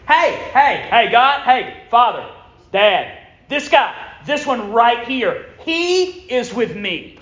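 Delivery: high, loud, insistent calling out; proudly flag down your father and single someone out; emphatic. Acted. Preacher acting Jesus calling to the Father, loud and emphatic. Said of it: "Here's the emphatic nature of the word".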